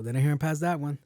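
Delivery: normal talking voice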